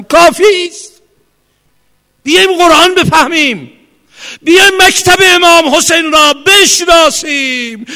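high, loud impassioned sermon shouting; fiery preaching. Real, not acted. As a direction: pound the words out to the crowd. Preaching at full force. (موعظهٔ پرشور با فریاد)

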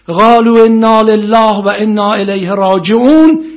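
loud, high, slow chanted verse from the pulpit; preaching at full force. Acted. Preacher to congregation, chanting the verse loud and slow.

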